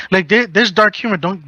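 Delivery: normal talking voice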